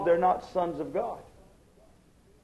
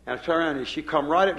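ordinary talk, nothing marked

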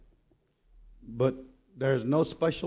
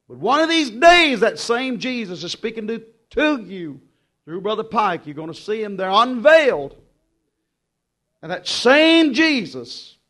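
loud, ringing preacher cadence; preaching; 6 semitones higher, 11 dB louder than usual. Acted. Preacher telling his church how God treats him, full-voiced. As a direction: preach it out to the congregation.

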